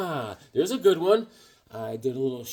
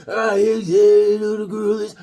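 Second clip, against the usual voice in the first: shouting voice of a friend, louder and higher; mock shout; 6 semitones higher, 7 dB louder than usual. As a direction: call out to Higgs like Easy. Voicing Easy calling out.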